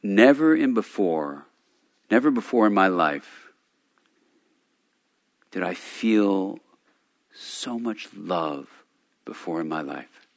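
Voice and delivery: slow, soft, tearful hush; quiet and teary. Retold or imitated. The moved parliamentarian confides it in the corner, slow and hushed. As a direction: let the words almost choke away, slow and soft. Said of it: "really quiet".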